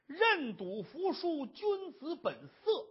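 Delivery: slow, high, weighty pronouncement; pompous declaiming. Acted. Gang boss lecturing the wife on debts, declaiming pompously. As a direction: stretch out each word like a maxim. Villain declaims gambling rule to captive.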